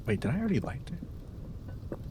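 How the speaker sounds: soft unvoiced whisper. Real, not acted. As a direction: whisper it under your breath. Quiet aside to himself.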